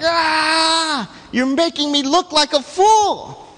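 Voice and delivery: high angry shout at the donkey; Balaam fuming. Retold or imitated. Balaam yelling at his stubborn donkey, high angry shout. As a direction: yell like a humiliated rider. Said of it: "And Balaam's like".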